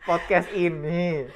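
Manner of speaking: slow, drawn-out announcement. Real, not acted. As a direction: announce it grandly and slowly. Dramatic anniversary announcement.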